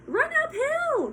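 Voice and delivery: very high, slow, loud sheep bleat; sheep crying out. Acted. Sheep crying out to Wilbur in a very high voice. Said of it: "cried the sheep".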